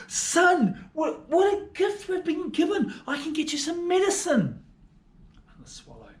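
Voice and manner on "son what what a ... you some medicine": much higher, tearful cry of joy; overjoyed mother. Acted. Mother to her sick son, high tearful joy. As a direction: cry out in joyful disbelief to your son.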